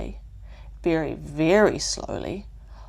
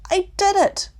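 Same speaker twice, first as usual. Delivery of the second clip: triumphant; 14 semitones higher, 7 dB louder than usual. Acted. Triumph after crossing the branch.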